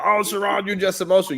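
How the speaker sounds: high-pitched mimicry of critics; mock the people who dismiss you; mocking imitation. Mimicking those who call her emotional.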